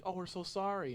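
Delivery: high, sing-song mock sympathy; imitating sympathetic friends. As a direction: friends consoling the left-out voice actor, lightly voiced. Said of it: "everybody's like".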